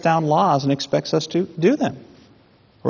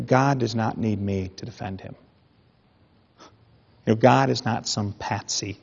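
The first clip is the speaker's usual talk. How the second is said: ordinary talk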